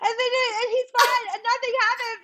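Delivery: very high, squeaky sobbing apology; imitated crying. Mother retelling her apology to the nanny, hammed-up crying. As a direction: wail it in a tiny tearful voice, hammed-up. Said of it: "Then I start crying".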